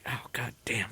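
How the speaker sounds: hushed whispered amazement; whispered stunned oath; awed whisper. Retold or imitated. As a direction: breathe it out in awe. Awed reaction to jump.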